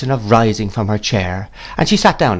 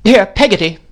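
high, loud summons to a servant; calling out; 11 semitones higher, 6 dB louder than usual. A narrator's reading. Miss Betsy cries through the parlour door, commanding. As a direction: call out imperiously. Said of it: "cried Miss Betsy".

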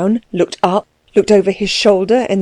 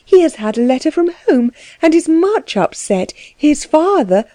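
distressed; 8 semitones higher than usual. A narrator's reading. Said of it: "she began with uplifted hands".

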